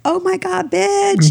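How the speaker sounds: high-pitched mock shriek of shock; shocked friend. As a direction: squeal it like a startled friend. Friend Juan reacts, shocked.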